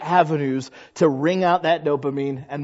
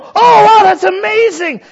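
much higher and louder, gushing oh wow; thrilled reaction; 11 dB louder than usual. Acted. Friend on the phone hearing answered prayer, thrilled. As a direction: react like the best news ever.

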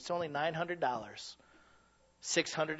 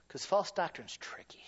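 whisper. Acted. Whispered then repeated softly.

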